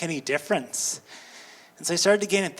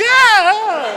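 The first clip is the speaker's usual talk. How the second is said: much higher, louder mock shriek; frightened scream; 14 semitones higher, 10 dB louder than usual. Skeleton actress startled by the rider, shrieking very high. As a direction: shriek like the scarer got scared.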